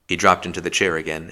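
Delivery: ordinary delivery, nothing marked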